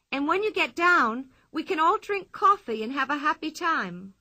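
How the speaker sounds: cheerful Pippi. Acted. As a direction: invite them kindly, brightly. Pippi voiced by narrator.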